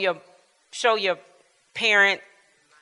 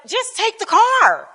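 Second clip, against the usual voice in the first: high, drawn-out imitation of a teen; imitated teen exasperation; 11 semitones higher, slower than usual. Granddaughter urging grandparent to leave, exasperated mimicry. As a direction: plead slowly up high, like an impatient granddaughter.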